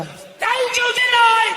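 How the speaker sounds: high, drawn-out shout; bellowed vendor call. Acted. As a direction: holler it slowly like a street seller. Mimics confident usher bellowing dessert.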